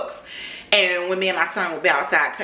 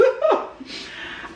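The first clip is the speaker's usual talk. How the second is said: high laugh; 4 dB quieter than usual. Real, not acted. Laughing at mortgage joke.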